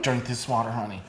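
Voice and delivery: soft motherly urging; gentle mother. Retold or imitated. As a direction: coax softly like a worried mom. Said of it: "my mom's like".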